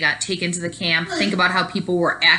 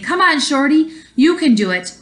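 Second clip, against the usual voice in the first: bright, higher, louder encouragement; encouraging cheer; 8 semitones higher, 5 dB louder than usual. Acted. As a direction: cheer a teammate on. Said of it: "my teammates who were saying".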